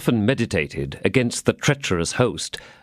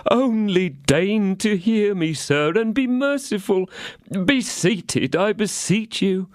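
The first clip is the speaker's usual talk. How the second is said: high, slow, begging host; pleading innkeeper; 11 semitones higher, slower than usual. A narrator's reading. Innkeeper pleading with the angry musketeer, high and slow. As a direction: beg to be heard.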